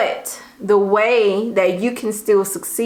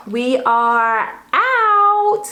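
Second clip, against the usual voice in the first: stretched sing-out, pitched high; 4 semitones higher, much slower than usual. Real, not acted. Signing off to viewers at the video's end, high voice. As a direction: draw the last word out long and high. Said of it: "like, the high voice".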